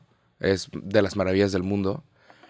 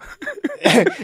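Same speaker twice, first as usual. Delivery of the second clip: laughing. Real, not acted. Laughs at the joke.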